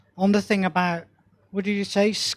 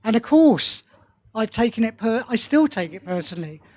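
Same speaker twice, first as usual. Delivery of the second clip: ordinary talk, nothing marked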